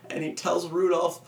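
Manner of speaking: ordinary delivery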